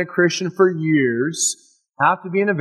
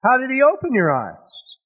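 pitched-up angry questioning; angry high demand; 10 semitones higher than usual. Acted. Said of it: "they're really mad".

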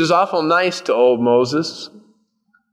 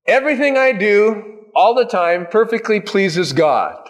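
high-pitched, emphatic claim; mock boasting; 8 semitones higher than usual. Imagined churchgoer standing up to boast, mock boasting. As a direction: declare it grandly, as an absurd boast. Voices an impossible boast to congregation.

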